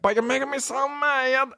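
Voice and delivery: high, whiny-mad little-kid impression; funny little voice. Teller shows how his young cousin sounded, funny high kid voice. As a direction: imitate a little cousin fuming in a tiny voice. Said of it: "he had a funny little voice".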